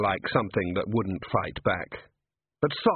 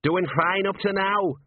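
high, drawn-out call from below; dopplering voice; 8 semitones higher than usual. A narrator's reading. Gnome still falling, calling back up, dopplering. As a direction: stretch it out like someone falling away. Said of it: "said a dopplering voice from below".

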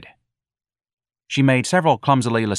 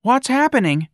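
put-on very high girlish voice; high, curious character voice; Darlene inquiring; 4 dB louder than usual. A narrator's reading. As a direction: call the question out high. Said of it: "Darlene inquired from behind the little curtain".

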